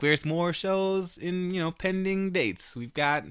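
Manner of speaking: high, slow, teasing dodge; playing coy. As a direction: drag it out like you're hiding a secret. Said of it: "they're definitely playing coy".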